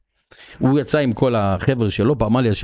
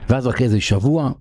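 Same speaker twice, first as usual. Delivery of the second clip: usual speaking voice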